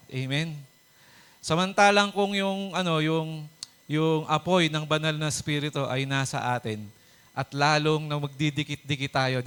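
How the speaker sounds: normal talking voice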